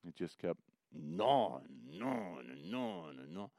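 fading repetition. Acted. Repeated gnawing fading out.

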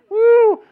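a sudden high, loud whoop; high loud woo. Real, not acted. Preacher joking to the church about the morning commute, with a high loud whoop. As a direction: whoop as if the morning drive were chaos. Exclaiming about road rage.

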